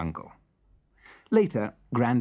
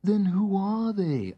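worried little elephant; 9 semitones higher, slower than usual. Acted. Melba asks, worried.

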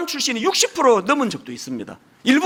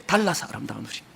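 "달라서 아름다운 우리"를 조용히 낮게 되뇌듯; 제목을 속삭이듯 여운 있게 반복; quiet echo; 8 semitones lower, 8 dB quieter than usual. Real, not acted. Sermon title repeated as echo. (제목을 조용히 되뇌며)

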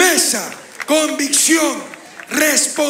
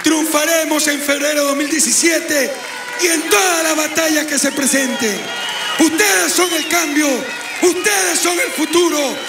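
ranting dictator speech; 4 semitones higher than usual. Real, not acted. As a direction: build to a roaring campaign climax. Rally speech climax, rising to shouts.

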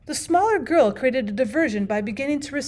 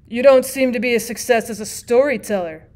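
louder dry jab at the aunt; sudden remark; 7 dB louder than usual. A narrator's reading. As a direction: cut in from the corner. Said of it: "said the bachelor suddenly".

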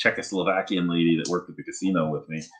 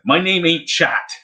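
indignant correction; 10 semitones higher, slower than usual. Retold or imitated. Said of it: "and I go".

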